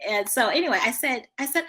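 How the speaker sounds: ordinary talk, nothing marked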